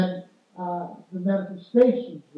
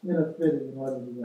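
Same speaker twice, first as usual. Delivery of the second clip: "in a fitting and orderly way" tossed off fast, lower and softer; rushed afterthought; 9 semitones lower, 6 dB quieter, much faster than usual. Shown as a demonstration. Preacher showing his reading, "orderly way" thrown away fast. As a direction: hurry through it as if it hardly matters. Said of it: "what I'm emphasizing is that everything should be done".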